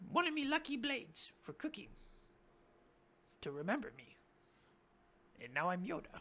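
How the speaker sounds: high goblin voice, giving a gift; squeaky goblin. Acted.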